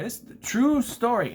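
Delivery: "true" punched high and loud; stress on TRUE. Acted. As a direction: hit "true" hard, mocking the title. Mocking emphasis on the title.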